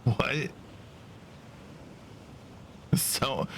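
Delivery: usual speaking voice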